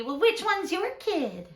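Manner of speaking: lightly voiced high-pitched inquiring mom; another mom's question. Mom at field day asking a stranger, high-pitched questioning.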